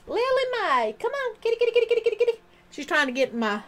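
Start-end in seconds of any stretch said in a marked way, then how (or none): 0.1-2.4 high sing-song call to a kitty; calling the cat. Real, not acted. Owner interrupts her story to call her cat, sing-song. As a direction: call the cat from across the room.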